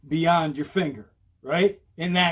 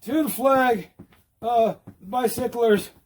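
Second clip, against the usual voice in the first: higher, measured recitation; mock pledge of allegiance; 4 semitones higher, slower than usual. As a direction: recite a joke pledge in chunks. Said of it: "What am I, pledging allegiance?".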